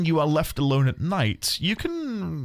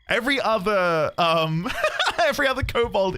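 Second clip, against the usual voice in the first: laughing; 8 semitones higher than usual. Real, not acted. Laughing at own wrong voice.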